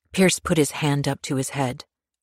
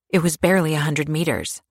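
ordinary delivery, nothing marked

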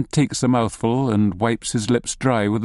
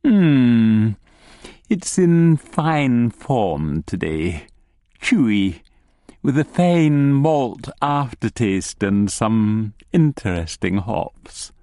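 very slow, drawn-out appraisal; savouring gnome; slower than usual. Acted. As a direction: savour each word like a beer taster. Gnome savouring his pint.

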